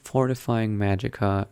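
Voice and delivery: usual speaking voice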